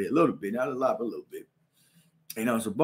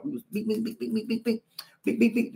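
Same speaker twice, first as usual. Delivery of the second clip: higher, softer beep punch noises; punch sounds; 8 semitones higher, 3 dB quieter than usual. Retold or imitated. Retelling the brawl, quick punch noises. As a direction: tap out the punches.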